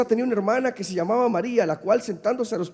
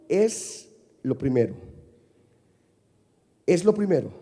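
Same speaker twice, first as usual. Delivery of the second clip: ordinary talk, nothing marked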